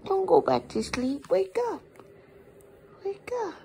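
usual speaking voice

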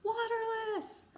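waterless said high and slow, falling; sing-song answer. Shown as a demonstration. Teacher revealing the answer to students, high sing-song.

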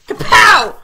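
high, loud comic-book kapow; playful attack cry. Pretend. Gamer striking a pig for good luck, playful attack cry. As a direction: burst it out like a cartoon punch.

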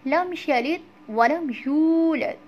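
slow, melodic tajweed recitation; drawn-out chanted recitation; Quran recitation. Shown as a demonstration. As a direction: recite each verse slowly and evenly. Teacher recites surah slowly.

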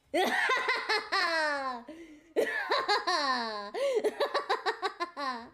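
long, high smug cackle; drawn-out gloating laughter. Pretend. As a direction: laugh at the puny mortals. Long laugh after boast.

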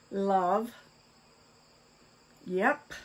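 ordinary delivery

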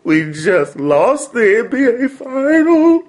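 loud, high, drawn-out wail; emotional wail. Acted. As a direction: break down into a slow, hammed-up cry. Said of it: "I'm a little bit emotional".

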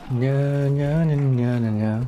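taunting nursery chant; sulky mock chant of nonsense syllables; mocking singsong. Real, not acted. Joueur vaincu se moque en chantonnant. (chantonnement moqueur)

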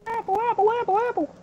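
very high squeaky chant. Pretend. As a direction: squeal it like a cartoon. Excited over an apple.

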